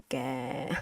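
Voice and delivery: "ga" soft and low, drawn out downhill; long, sinking tone on "ga"; sliding down. Shown as a demonstration. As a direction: slide "ga" down like a slope. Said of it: "You slide on the slope".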